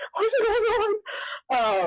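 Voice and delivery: very high, drawn-out panicked squeal; freaking out. Retold or imitated. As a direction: stretch it into a retold squeal of panic. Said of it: "freaking out".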